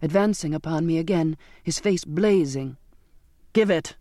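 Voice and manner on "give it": curt, forceful demand; captain's angry order; crying out. A narrator's reading. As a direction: demand it, closing in. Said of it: "the captain cried".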